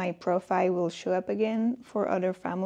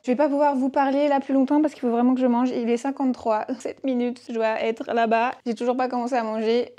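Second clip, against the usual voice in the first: rushed; 3 semitones higher, 8 dB louder, faster than usual. Real, not acted. Vlogger telling viewers she must eat before leaving, rushed. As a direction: rattle it out loudly, pressed for time. Little time left to eat.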